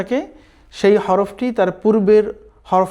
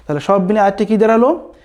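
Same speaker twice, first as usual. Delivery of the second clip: ordinary delivery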